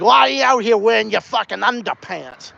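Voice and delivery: mock New England old lady; hammed-up New England accent; old lady's northern accent. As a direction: imitate an old Massachusetts lady, dropping the Rs. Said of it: "some fucking old lady from, like, fucking, like, Mass".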